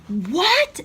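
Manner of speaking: high, loud retold scream; screamed what. Brother hearing the news, a retold shocked scream. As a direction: shout it in shock, half-played. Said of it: "screamed it".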